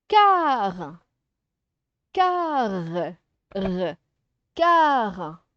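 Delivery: CAR stretched, its final R sounded out; isolated syllable. Shown as a demonstration. Teacher helping pupils hear the R, isolated syllable. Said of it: "le son R".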